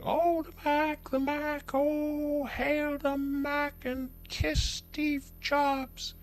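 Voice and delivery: very high, slow, swooning fanboy voice; high drawn-out adoring fan; mock Mac fanboy. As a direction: gush worshipfully over the Mac, up high and slow. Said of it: "some people saying".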